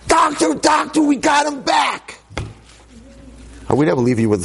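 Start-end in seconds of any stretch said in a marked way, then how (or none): 0.1-2.2 excited nurse shout. Retold or imitated. Nurse bursting out of surgery to the doctor, shouting with joy. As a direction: burst out yelling the miracle. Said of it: "the nurse comes flying out".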